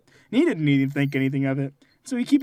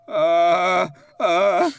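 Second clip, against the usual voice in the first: louder, dazed stammer; a stunned kid's groan; mock dazed groan; 6 dB louder than usual. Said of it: "he goes".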